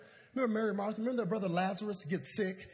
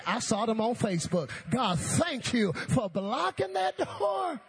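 relieved testimony; 7 dB louder than usual. Acted. Preacher voices someone grateful in hindsight, loud building testimony.

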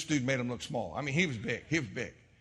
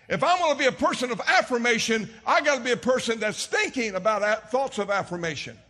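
loud, high, driving preacher's cadence; louder and higher full-force sermon delivery; preaching at full force; 7 semitones higher, 7 dB louder than usual. Real, not acted. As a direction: build the point toward a climax.

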